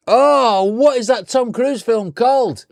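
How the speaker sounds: long, loud groaning "oh", then slow, high question; agonised wail of trying to remember; exasperated. Real, not acted. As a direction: drag it out in real frustration. Still cannot recall the title.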